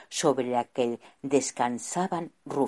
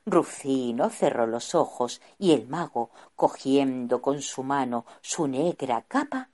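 ordinary delivery

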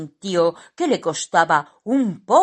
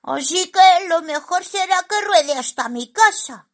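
high, loud squeaky resolve; decide it in a tiny cartoon voice; high-pitched comic thinking aloud; little character reasoning; 14 semitones higher, 6 dB louder than usual. A narrator's reading. Simón decides to roll home.

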